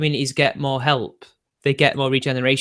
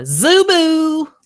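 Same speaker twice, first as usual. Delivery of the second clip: silly squeal; 13 semitones higher, 6 dB louder than usual. Pretend. Sudden high squeal.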